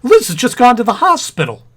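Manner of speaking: loud, high-pitched rush of panic; excited. Acted. Husband panics telling his friend Liz is at the hospital, loud excited blurt. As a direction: blurt it out, heart racing. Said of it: "Getting excited won't help".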